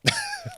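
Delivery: short high-pitched laugh; high burst of laughter. Real, not acted. Laughing at the memory.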